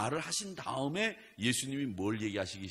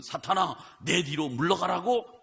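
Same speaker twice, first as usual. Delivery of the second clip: loud, high, commanding 사탄아 with 내 rising; loud rebuke; 9 semitones higher, 9 dB louder than usual. Retold or imitated. Jesus rebuking Peter, loud commanding shout. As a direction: thunder the rebuke at Satan. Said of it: "또 예수님은".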